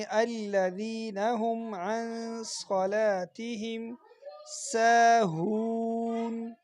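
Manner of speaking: very slow melodic tajweed; measured Quranic chant; Quran recitation. Shown as a demonstration. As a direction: draw out each word like a reciter.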